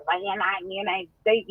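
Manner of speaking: normal talking voice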